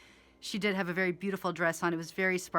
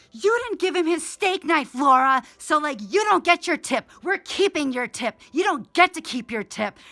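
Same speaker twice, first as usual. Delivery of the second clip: much higher and louder, hammed-up mock scolding; angry bosses scolding; 6 semitones higher, 9 dB louder than usual. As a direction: bark like furious restaurant bosses. Bosses scolding over steak knife.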